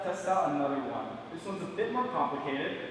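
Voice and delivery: ordinary delivery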